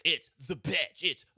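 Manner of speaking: high, hammed-up radio-host voice; mocking radio hosts. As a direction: ham up the silly segment names. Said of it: "I remember specifically just laughing at".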